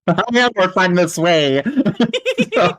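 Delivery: giggling through every word; laughing. Real, not acted. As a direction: laugh while talking, can't stop.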